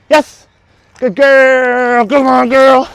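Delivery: very high, loud, drawn-out praise and coaxing; high excited praise. Real, not acted. Trainer gushing and coaxing dog, very high and loud. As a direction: gush over the dog and urge her in. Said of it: "You're so animated".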